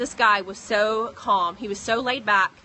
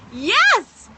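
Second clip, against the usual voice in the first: a much higher, louder real cheer; triumphant yes; 11 semitones higher, 9 dB louder than usual. Real, not acted. Telling viewers the baby is fine, triumphant cheer. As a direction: shout it like you've won. Celebrating the good news.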